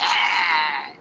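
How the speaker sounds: long snarl like an angry dog; imitated dog snarl. Preacher imitates possessed girl snarling like a dog, imitated snarl. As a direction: mimic a dog baring its teeth and growling. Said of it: "me hizo como un perro cuando regaña".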